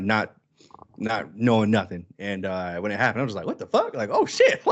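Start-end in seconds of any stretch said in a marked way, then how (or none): 3.5-4.6 high-pitched, hammed-up astonishment; retold shock. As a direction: squeal it like a stunned moviegoer. Acts out own shocked theater reaction.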